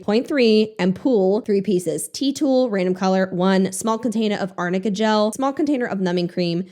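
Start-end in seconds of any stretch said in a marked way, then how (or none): none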